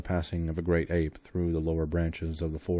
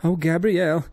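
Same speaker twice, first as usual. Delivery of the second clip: high, loud, panicked woman's cry; frightened maid screaming; terrified scream; 11 semitones higher, 10 dB louder than usual. Acted. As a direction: shriek in sudden fright. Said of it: "she screamed".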